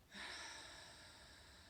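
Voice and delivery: long, soft breathy exhale; long sigh. Real, not acted. Host settling into a session on fear, long sigh. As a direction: let out a slow, voiceless sigh.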